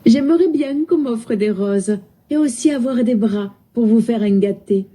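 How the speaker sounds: southern French lilt, starting high; southern French accent. Shown as a demonstration. Assistant wishing for arms to hug, southern French accent. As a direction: imitate the singsong accent of Provence. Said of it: "parle avec l'accent du sud".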